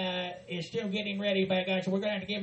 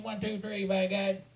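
ordinary talk

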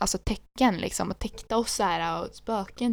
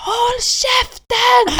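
high, loud, slow scream; screaming shut up; 9 dB louder, much slower than usual. Retold or imitated. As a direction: scream it at your friend. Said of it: "hon skrek".

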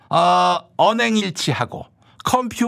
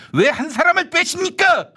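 shouted plea; 11 semitones higher than usual. Acted. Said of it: "소리만 지르지 말고".

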